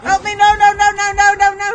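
high, loud panicked pleading; panicked cries. Real, not acted. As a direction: cry for help in real panic.

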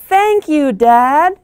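put-on high, bright little-girl thanks; thankful child. Jenny thanking Dad for her new dog, delighted. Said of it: "said Jenny".

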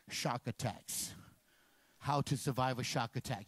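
slow, low deadpan. Acted. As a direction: drag it out deadpan. Dramatic shark tab mention.

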